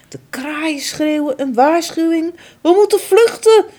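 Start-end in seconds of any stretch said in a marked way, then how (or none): 2.6-3.6 high, loud urgent alarm; alarmed call. Acted. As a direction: warn the herd to flee. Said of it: "roept een van de reeën".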